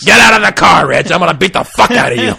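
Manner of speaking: comic tough-cop threat in a put-on voice; Nick Nolte. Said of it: "Nick Nolte beat the shit out of him".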